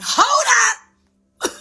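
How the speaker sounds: high, sudden mock-dramatic cry; dramatic objection. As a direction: cut the thought off with a high yell. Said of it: "it will be real dramatic".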